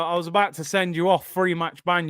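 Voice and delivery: normal talking voice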